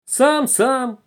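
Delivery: high, loud wailing mimicry; tearful shout. A narrator's reading. Conductress scolds the guilty boy, tearful shouting. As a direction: cry out in tearful exasperation. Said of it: "закричала она плачущим голосом".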